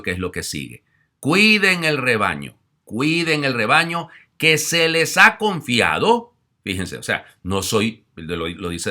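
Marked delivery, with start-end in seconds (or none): 1.2-6.3 loud, high, slow pastoral plea; slow exhortation. Acted. Priest urging pastors to tend the flock, loud and slow. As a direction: drag it out as a solemn charge.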